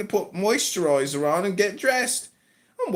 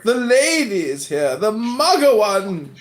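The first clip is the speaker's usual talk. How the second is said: hammed-up British rant, pitch jumping up; put-on British accent. British comic rants at Lady Anne, hammed-up British accent. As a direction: rant like a crude British puppet character.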